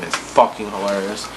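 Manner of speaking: ordinary delivery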